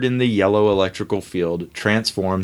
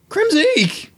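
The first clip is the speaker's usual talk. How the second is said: very high, loud creature shriek; creature screech; 3 dB louder than usual. Acted. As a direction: screech like a small monster. Creature cries its name.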